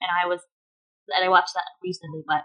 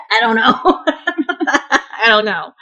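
laughing; 3 semitones higher, 5 dB louder than usual. Real, not acted. Laughing at own admission.

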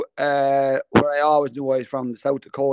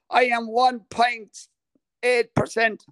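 slow, higher-pitched announcement; measured build-up, pitched up; suspenseful reveal; 7 semitones higher, slower than usual. Real, not acted. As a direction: draw it out before the punchline. Slow build to surprise result.

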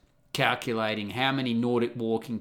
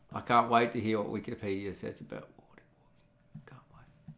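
whisper; 4 semitones lower, 7 dB quieter, faster than usual. Real, not acted. Whispered aside while searching.

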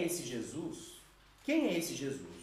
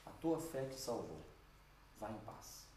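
tender blessing; 7 semitones lower, 11 dB quieter than usual. Retold or imitated. Jesus tenderly to healed woman.

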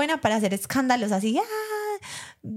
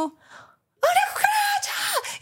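high, drawn-out shriek of panic; mock shriek; much slower than usual. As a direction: shriek it like a scared girl on a table. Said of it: "yo no soy como".

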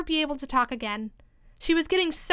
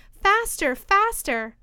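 urgent storybook cry, pitched up at first; 7 semitones higher, 4 dB louder, much slower than usual. A narrator's reading. Queen urging Alice as they run, crying out. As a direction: call out each word as an order. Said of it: "the queen kept crying".

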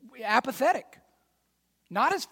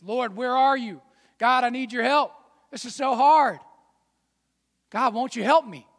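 pleading, louder cry to God for help; desperate prayer; 5 semitones higher, 8 dB louder than usual. Retold or imitated. Worried believer crying out to God for help. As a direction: beg God like things are falling apart.

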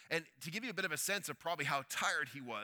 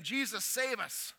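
plea for help; 6 semitones higher, 3 dB louder, slower than usual. Acted. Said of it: "This wasn't them saying".

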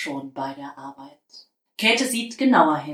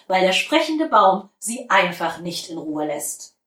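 usual speaking voice

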